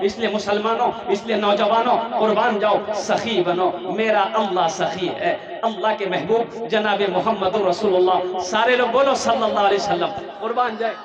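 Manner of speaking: loud rallying sermon voice; full-force preaching. Real, not acted. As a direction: exhort the congregation loudly.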